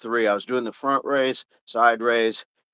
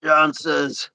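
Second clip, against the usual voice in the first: 1950s narrator; 3 semitones higher than usual. Pretend. Continuing the announcer bit.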